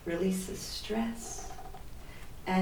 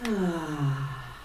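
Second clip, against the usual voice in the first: relaxed sigh; 4 semitones lower than usual. Shown as a demonstration. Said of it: "let your voice just make a relaxed sound".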